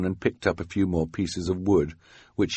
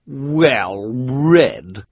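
higher, louder mocking echo; nasty sneer; 6 semitones higher, 5 dB louder than usual. A narrator's reading. Esme repeating Klaus's praise scornfully, nasty sneer. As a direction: throw the words back with nasty scorn. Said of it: "in a particularly nasty tone of voice".

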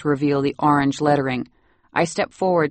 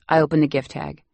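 ordinary delivery, nothing marked

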